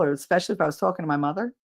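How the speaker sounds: ordinary talk, nothing marked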